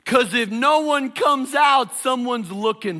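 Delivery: high, loud preacher shout. Real, not acted. Preacher shouting to the congregation about the risk. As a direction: throw it to the back row.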